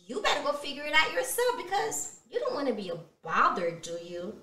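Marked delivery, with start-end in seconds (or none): none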